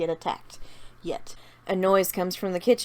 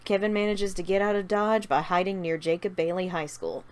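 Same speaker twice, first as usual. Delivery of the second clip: plain reading voice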